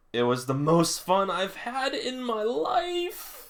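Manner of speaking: put-on high, slow, joyful cry of a boy; excited shout. Reader voicing Hinata's joyful outburst, high and slow. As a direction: belt it out like the best day ever. Reading Hinata's big line aloud.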